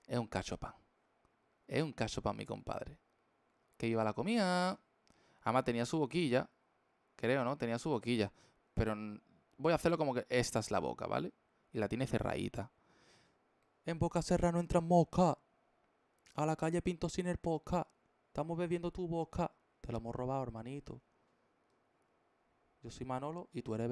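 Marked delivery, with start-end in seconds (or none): 3.8-4.8 a bit higher, mild cartoon cheer; half-hearted glutton cheer; lightly voiced cartoon cheer. Streamer lightly voices the food character again. 13.8-19.5 higher, louder cartoon voice; silly character voice. Streamer voices his closed-mouth drawn character.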